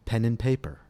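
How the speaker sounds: "and" said as a quick n, pen 'n paper; reduced and. Shown as a demonstration. Teacher gives a model phrase to repeat, pen 'n paper. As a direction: slur "and" to just n. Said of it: "listen and repeat ... the reduced form n".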